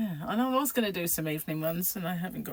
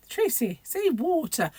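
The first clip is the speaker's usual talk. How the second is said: put-on higher-pitched mum telling her child off; lifted voice of a correcting mother; 9 semitones higher, 3 dB louder, slower than usual. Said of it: "she must have said water".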